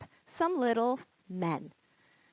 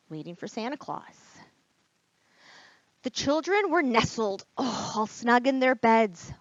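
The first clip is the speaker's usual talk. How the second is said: put-on lively read-aloud for children; teacher reading The Night Before Christmas to kids, animated; storybook reading; 2 semitones higher, 4 dB louder than usual. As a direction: read it with wide-eyed wonder, like bedtime.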